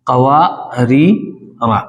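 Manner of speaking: कवारीरा stretched slowly, ending with a long falling रा; drawn-out recitation. Shown as a demonstration. Teacher shows students how to recite कवारीरा, drawn out slowly. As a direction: recite कवारीरा very slowly, every syllable held. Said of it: "और उसके बाद ये था".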